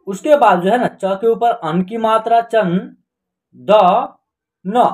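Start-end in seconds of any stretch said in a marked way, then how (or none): none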